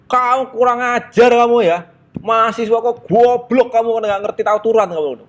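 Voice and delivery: furious conductor. Retold or imitated. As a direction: spit out each insult at the students. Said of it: "marah besar itu kondekturnya".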